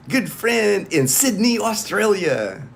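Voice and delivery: normal talking voice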